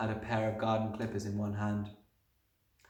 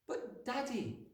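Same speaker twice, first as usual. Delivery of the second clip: put-on high, soft, slow little girl; small timid protest, drawn out; pleading child; 13 semitones higher, 8 dB quieter, slower than usual. A narrator's reading. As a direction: appeal meekly to your father.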